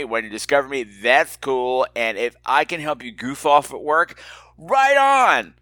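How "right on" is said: high, drawn-out cheer; enthusiastic cheer. Real, not acted. Host to listener slacking at work, enthusiastic cheer. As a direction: cheer them on, pitched way up.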